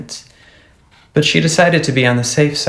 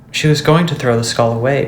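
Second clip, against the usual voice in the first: ordinary delivery, nothing marked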